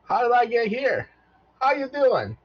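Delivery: high, loud chirpy robot greeting; a cheerful little robot waking up. Acted. GM as robot greeting the party, high and chirpy.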